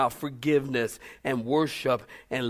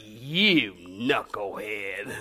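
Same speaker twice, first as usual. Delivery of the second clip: mock insult; 8 semitones lower, much slower than usual. Spouse insult, slow low.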